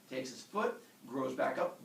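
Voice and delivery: usual speaking voice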